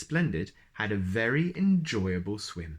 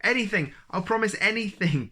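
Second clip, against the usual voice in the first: much higher, louder desperate plea; pleading; 10 semitones higher, 5 dB louder than usual. Acted. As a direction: beg between giggles. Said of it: "pleaded the dragon".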